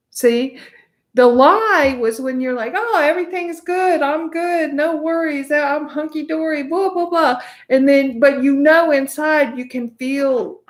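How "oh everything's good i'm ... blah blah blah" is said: higher-pitched, mock carefree patter; breezy denial. Speaker mimics someone lying to themselves that all is good, breezy denial. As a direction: play it falsely carefree, pitched up. Acting out the lie to oneself.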